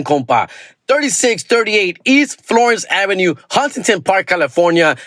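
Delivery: fast-talking local ad pitchman; hype ad read. Acted. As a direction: sell it like a late-night radio spot.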